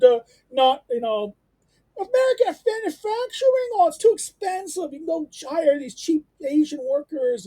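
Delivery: high, loud sing-song parody of a libertarian; mock the free-trade friend in a high, airy voice. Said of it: "this is my thing about my libertarian friends".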